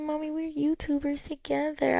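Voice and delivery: high, light imitation of a little girl; mother imitating her daughter in a high little voice; child voice. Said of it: "She said".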